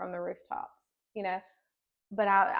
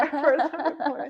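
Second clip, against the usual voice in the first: high, loud, laughing as you say it; laughter bursting through the retold line; laughing retold answer; 7 semitones higher, 9 dB louder than usual. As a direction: crack up while quoting yourself. Laughing at her own awkward retold answer.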